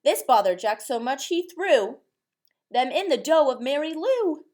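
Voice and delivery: ordinary delivery, nothing marked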